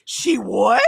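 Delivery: a son who can't believe it; imitating her son's shocked reply. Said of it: "And he was like".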